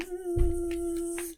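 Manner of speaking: soft high buzz; storyteller buzzing as the fly arrives. Acted.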